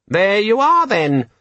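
a tiny demon selling its features; high, eager electronic assistant; chirpy gadget. A narrator's reading. Disorganizer voice.

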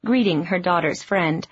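plain reading voice

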